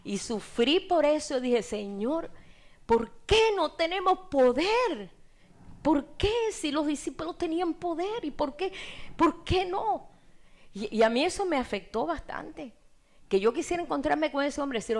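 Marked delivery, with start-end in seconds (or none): 1.6-10.1 high, slow pleading cry to God; high, drawn-out lament of a prayer; anguished prayer. Retold or imitated. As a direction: beg the Lord with a breaking heart.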